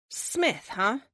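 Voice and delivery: Smith pitched high, amused doubt; skeptical echo. Acted. Amelia doubts the agent's generic name, amused and skeptical. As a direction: tease the name back at him.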